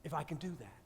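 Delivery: quiet, grave retold promise; subdued, earnest reluctance; dead serious. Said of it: "he's dead serious".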